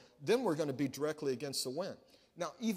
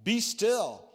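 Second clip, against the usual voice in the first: rebuking command; 5 semitones higher, 9 dB louder, much slower than usual. Acted. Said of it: "rebuked the wind and the waves and said".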